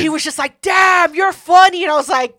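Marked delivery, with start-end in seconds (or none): 0.6-1.8 loud, high excited "damn, you're funny"; hyped shout. Retold or imitated. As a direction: shout it like he's impressed. Retold guy hyped reaction.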